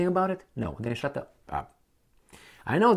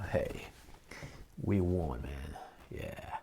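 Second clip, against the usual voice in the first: softer, hushed "we won, man" with a sigh; whispered mock celebration; 9 semitones lower, 14 dB quieter, slower than usual. Mocking the coward who hid, quietly claiming the win. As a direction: sneak the victory line under your breath.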